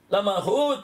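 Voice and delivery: ordinary talk, nothing marked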